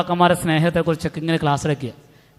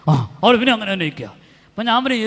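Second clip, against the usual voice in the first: usual speaking voice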